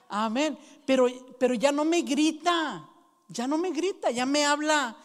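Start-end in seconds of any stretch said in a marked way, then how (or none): 0.1-0.6 laughing amen. Real, not acted. Laughing after story.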